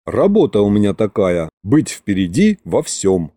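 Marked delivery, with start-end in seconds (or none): none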